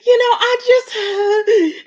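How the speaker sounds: high, drawn-out imitation of crying; mock tearful overwhelm. Retelling Nicole's return in tears, mock tearful. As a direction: imitate an overwhelmed woman choking up, slow and high. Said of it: "she's overwhelmed ... and she's crying".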